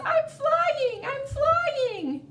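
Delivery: very high, delighted squeal; a falling fish squealing with glee; happy fish. Acted. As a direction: sing it out like a fish in the air. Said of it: "the fish is happy now and says".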